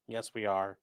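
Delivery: ordinary delivery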